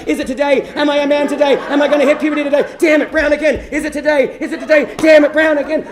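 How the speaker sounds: high, loud, hopeful shouting; frantic anticipation crashing into loud exasperation; mock excitement. Boy checking the toilet every day for puberty, mock excitement. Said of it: "it was with an air of excitement".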